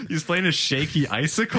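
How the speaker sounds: high, incredulous question through laughter; laughing disbelief. Real, not acted. Host repeats co-host's joke back, laughing disbelief. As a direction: repeat it as if you can't believe it, cracking up.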